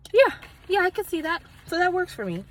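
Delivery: bright, high, eager yeah yeah; excited agreement. Real, not acted. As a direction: perk right up, delighted. Reacts to the label text.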